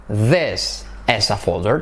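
slow low demonstration; slow model. Shown as a demonstration. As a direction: say it slowly and low. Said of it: "Otra variación".

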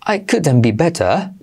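English-style better with no final r; British couldn't be better; British accent. Shown as a demonstration. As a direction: say it as in England. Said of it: "creo que lo dicen así en Inglaterra".

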